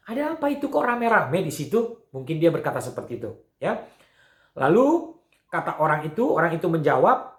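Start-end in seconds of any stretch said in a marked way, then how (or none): 0.1-2.1 lightly acted curious question, raised pitch; puzzled blind man. Blind beggar asking passers-by about the crowd, lightly puzzled. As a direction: ask what the commotion is, higher than usual.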